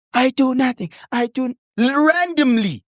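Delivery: high, gushing imitation of a stranger; imitating a lady. Mother recounting a stranger who grabbed her son, high mock gushing voice. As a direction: mimic a fussing stranger lady. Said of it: "this one lady literally goes".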